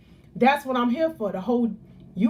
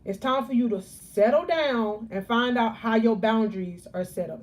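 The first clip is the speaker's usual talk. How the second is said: usual speaking voice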